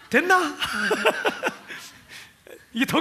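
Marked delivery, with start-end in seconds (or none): 0.1-0.6 Gyeongsang dialect. Acted. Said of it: "경상도 사람이 얘기한다". 0.6-1.8 breathless laugh. Real, not acted. Laughing after the dialect reading.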